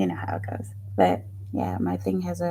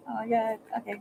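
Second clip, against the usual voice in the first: usual speaking voice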